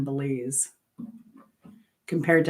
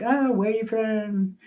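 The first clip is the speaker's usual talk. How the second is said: higher, drawn-out Australian greeting; slow Australian lilt, pitched up; Australian accent; 6 semitones higher, slower than usual. Retold or imitated. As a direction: lightly mimic a friendly Australian. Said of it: "they're Australian".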